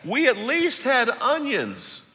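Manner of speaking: hammed-up whining crowd; whining Israelites. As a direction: whine at Moses like spoiled complainers. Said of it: "this is what they said".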